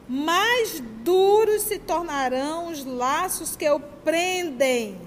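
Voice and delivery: reads the quote slowly, leaning on each word; slow weighty reading. Shown as a demonstration. Speaker reading an André Luiz passage aloud to the audience, slow and weighty. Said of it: "Olha a palavra".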